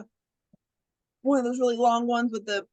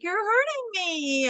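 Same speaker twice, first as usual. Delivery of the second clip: high, drawn-out mock whine; whiny complaint; 9 semitones higher, slower than usual. Stabbed killer complains to his accomplice, mock whining. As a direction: whine it slowly, imitating a wounded partner.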